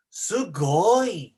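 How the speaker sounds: bright amazed gasp of a word; excited exclamation. Shown as a demonstration. Teacher modelling amazement at the peach, excited. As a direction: show the wonder at the peach. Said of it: "When she sees the peach".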